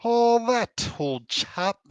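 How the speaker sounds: playful mock British toff accent; pompous British accent. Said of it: "a little bit of a pompous British accent".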